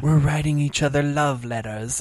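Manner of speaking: lightly put-on lofty love-letter voice; mock Shakespearean lover. Host imagining prisoners' love letters, mock Shakespearean. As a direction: play at lofty Victorian romance. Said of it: "Victorian, like, you know, Shakespearean type thing".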